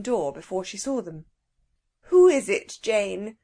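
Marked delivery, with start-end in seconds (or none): 2.0-3.4 sour voice. A narrator's reading. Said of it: "she asked in a sour voice".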